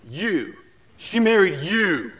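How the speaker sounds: high, loud, slow and laughing in disbelief; a gobsmacked customs officer, half laughing; stunned disbelief. Retold or imitated. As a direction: play the officer who can't believe it. Customs officer stunned by wife's name.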